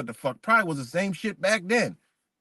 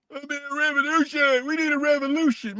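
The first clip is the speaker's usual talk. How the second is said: high mocking voice of an eager revolutionary; 12 semitones higher than usual. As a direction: ham up a naive activist crying for revolution. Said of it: "In the modern day revolutionaries".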